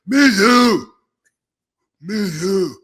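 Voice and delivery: Bobby impression voice. Host doing Bobby's voice for the listeners, high put-on rasp. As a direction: mimic him with a strained high squeeze. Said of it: "when I do Bobby".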